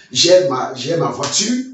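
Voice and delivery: usual speaking voice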